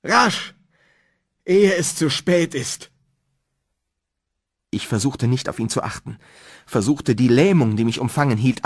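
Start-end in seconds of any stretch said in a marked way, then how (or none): 0.0-2.9 high-pitched, shaken by fear; scared, hopeless urging; fearful resignation. A narrator's reading. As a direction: plead with despairing urgency. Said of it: "sanfteren Klang hoffnungsloser Resignation".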